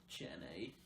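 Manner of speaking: breathy whispered call of Jenny; whispering the name Jenny to get a laugh. Retold or imitated. Forrest Gump calling Jenny.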